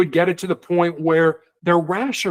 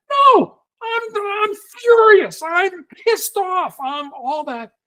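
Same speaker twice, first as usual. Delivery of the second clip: much higher and louder, acting out rage; furious hostage taker; 14 semitones higher, 3 dB louder, slower than usual. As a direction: play the furious caller who won't be calmed. Hostage taker acts out fury.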